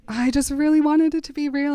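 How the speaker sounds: high plaintive whine; mock tearful. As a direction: plead like a weepy girl. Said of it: "She's screaming and sobbing".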